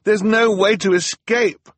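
high, loud outcry; despairing cry. A narrator's reading. Klaus trapped by the approaching mob, crying out. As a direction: cry out that all is lost. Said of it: "Klaus cried".

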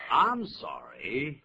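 slow, emphatic classroom model; stretched teaching model. Shown as a demonstration. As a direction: stretch every sound for the class. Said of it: "很抱歉怎么样呢".

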